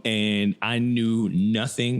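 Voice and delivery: knew drawn out slowly, rising at the end; slow emphasis. Real, not acted. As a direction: lean hard on knew, slow and deliberate. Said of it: "When I say I knew nothing".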